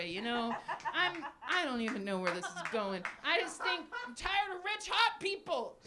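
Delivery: usual speaking voice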